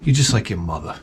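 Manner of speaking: low, soft mocking retort; quiet low dismissive mock reply. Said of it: "It's like".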